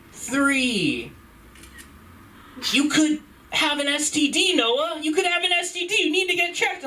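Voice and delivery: high-pitched, hammed-up accusation; imitated scolding girlfriend. Girlfriend confronting him about his number, high mock scolding.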